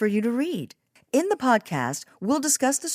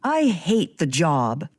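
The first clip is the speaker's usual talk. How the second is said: slow, tight, through the teeth; mild hint of gritted anger; 4 semitones lower, slower than usual. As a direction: bite off each word through clenched teeth. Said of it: "she gritted her teeth".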